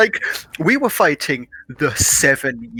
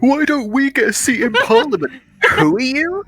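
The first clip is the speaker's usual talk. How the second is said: mock indignant colonist; 12 semitones higher, 4 dB louder than usual. Mock colonist versus Parliament.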